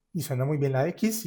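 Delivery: ordinary talk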